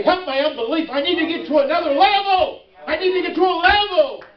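rising, high prayer cry; fervent preaching. Acted. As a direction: build to an impassioned plea. Preaching at full force.

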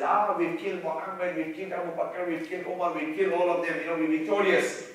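usual speaking voice

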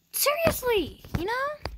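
high squeaky exasperated seriously; exasperated squeal. Real, not acted. Vlogger venting to viewers, squeaky exasperated. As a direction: squeal it out in frustration. Venting about being mad.

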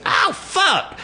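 very high squealed ow after a slap; hammed-up pained squeal; mock yelp of pain. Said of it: "And he went".

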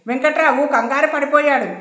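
ordinary delivery, nothing marked

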